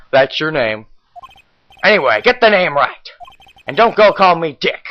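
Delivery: ordinary talk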